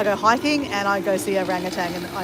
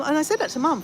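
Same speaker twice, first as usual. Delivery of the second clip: ordinary delivery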